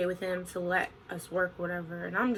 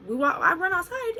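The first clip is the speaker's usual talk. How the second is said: usual speaking voice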